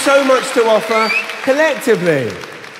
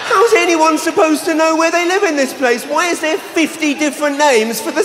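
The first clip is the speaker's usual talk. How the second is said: exasperated shout; 8 semitones higher than usual. Real, not acted. Exasperated rant.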